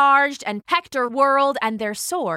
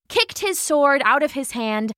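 plain reading voice

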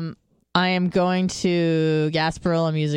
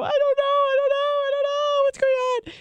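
very high-pitched mock wailing; hammed-up tearful squeal; imitated crying. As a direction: wail helplessly, acting out a hysterical cry. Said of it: "actually crying".

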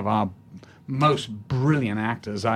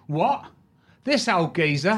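higher, incredulous Cockney; 5 semitones higher than usual. Retold or imitated. Cockney shown Laurence Olivier, scoffing incredulous Cockney. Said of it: "this Cockney comes in".

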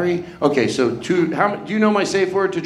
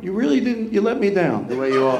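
usual speaking voice

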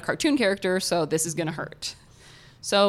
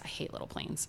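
whisper; 15 dB quieter than usual. Real, not acted. Fear of little planes.

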